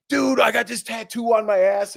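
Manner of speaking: high-pitched imitation of a hyped dude; mock bro excitement; fired up. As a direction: brag like a pumped-up contestant, pitched high. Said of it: "like, really fired up".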